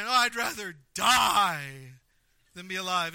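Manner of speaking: loud, dragged-out melodramatic wail; hammed-up sulking outburst; tantrum-throwing Jonah. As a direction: stretch DIE like a child in a fit. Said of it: "Jonah is throwing a temper tantrum".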